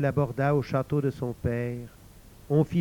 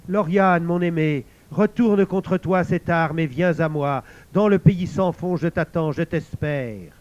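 soaring, louder lover's call, slowing at the end; the lover's head calling out to Lauriane, impassioned; frantic voice; 4 semitones higher, 7 dB louder than usual. A narrator's reading. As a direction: cry out to her, desperate and grand. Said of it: "une voix s'éleva, éperdue, magnifique".

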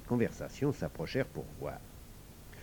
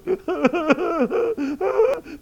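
high-pitched acted bawling; hammed-up little girls' crying; loud wailing sobs; 10 dB louder than usual. A narrator's reading. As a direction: burst into shrill mock sobs. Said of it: "se mirent à pleurer".

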